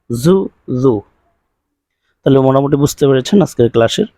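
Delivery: usual speaking voice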